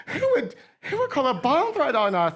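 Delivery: high-pitched imitation of upset classmates; mock upset. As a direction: wail it high, imitating shocked students. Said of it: "everybody was so upset".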